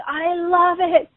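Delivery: very slow, high, cooing imitation; gushing singer. The singer cooing at her fan in costume, slow and high. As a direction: stretch it out high and adoring. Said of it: "and she's like".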